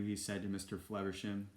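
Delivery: ordinary delivery, nothing marked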